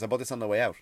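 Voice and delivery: ordinary delivery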